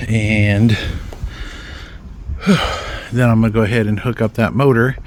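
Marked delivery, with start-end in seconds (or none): 0.8-3.1 long sigh. Real, not acted. Tired pause mid-job.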